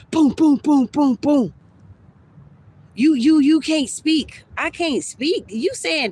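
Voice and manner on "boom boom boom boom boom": loud, punchy booms one after another; taser sound effect. Retold or imitated. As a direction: hammer out each boom like a shot. Imitating the taser shots.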